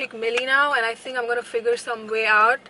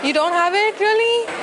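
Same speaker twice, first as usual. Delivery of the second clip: high, louder disbelieving cry; dismayed disbelief; 9 semitones higher, 4 dB louder than usual. Real, not acted. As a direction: whine it out in shock. Store lacks her bronzer.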